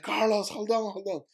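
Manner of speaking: high, urgent call to hold on; friend shouting. Retold or imitated. Friend calling to the swimmer before running for help, urgent. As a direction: yell it across the water. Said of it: "So he's like".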